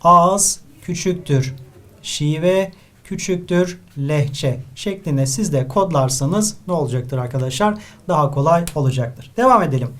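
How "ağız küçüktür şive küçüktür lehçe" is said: spelled out very slowly; slow formula. Shown as a demonstration. Teacher giving students a memory formula, very slow. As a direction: lay out the formula word by word. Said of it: "şöyle bir formül yapıyorum".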